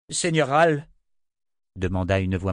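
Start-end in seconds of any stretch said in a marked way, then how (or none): 0.1-0.9 higher, louder call. Acted. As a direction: call for his attention. General calls out.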